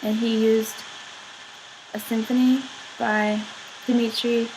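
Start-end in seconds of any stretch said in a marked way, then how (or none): none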